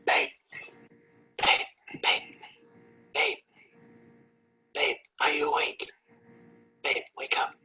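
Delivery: hushed, breath-only bedtime whisper; acted stage whisper with pauses; whispered nudging. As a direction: whisper insistently to wake a sleeping partner. Acting waking a sleeping partner.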